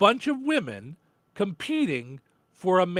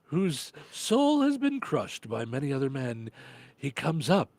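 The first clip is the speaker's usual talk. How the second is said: nature documentary narrator; 5 semitones lower, 5 dB quieter, slower than usual. Pretend. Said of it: "Australian accent should be narrating".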